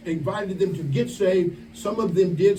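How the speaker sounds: normal talking voice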